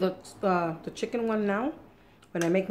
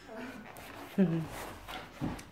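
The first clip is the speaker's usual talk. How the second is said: soft laugh; 4 dB quieter than usual. Real, not acted. Laughs at own napkin remark.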